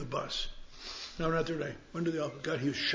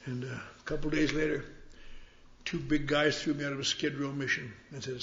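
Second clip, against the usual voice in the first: ordinary talk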